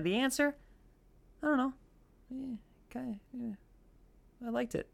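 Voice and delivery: high, soft, embarrassed shrug; sheepish. Real, not acted. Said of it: "joking shrugging answer".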